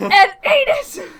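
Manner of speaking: high, very loud, drawn-out melodrama; hammy dramatic shout. Acted. Mocking the potato chip moment, overblown dramatic shout. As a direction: bellow it like a huge climax.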